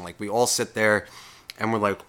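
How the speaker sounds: ordinary delivery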